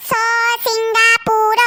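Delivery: drawn-out સ-, Singapore, stuttered start then slow and firm; slow spelled-out repeat. Acted. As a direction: say Singapore slowly, syllable by syllable, for a deaf ear. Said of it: "પાછું બોલ તો".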